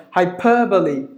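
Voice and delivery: "Hyperbole" as hai-PER-buh-lee; teacher's model of "Hyperbole", weight on PER; stress on PER. Shown as a demonstration. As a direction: punch PER in "Hyperbole", keep four syllables. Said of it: "Per should be given more volume".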